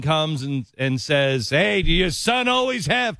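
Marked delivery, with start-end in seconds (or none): 1.5-3.2 high-pitched mock boisterous call; boisterous imitation. Host mimicking the vice president's crude question, boisterous. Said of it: "extremely loud and boisterous voice".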